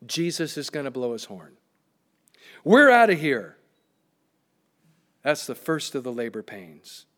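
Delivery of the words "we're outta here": loud, triumphant burst; acted exclamation. Preacher as believers when Jesus blows horn, exultant call. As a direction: call it out like a sudden departure.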